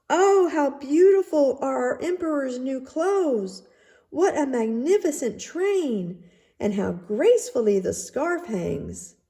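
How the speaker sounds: the crowd pretends to admire; crowd crying out. A narrator's reading. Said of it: "cried out".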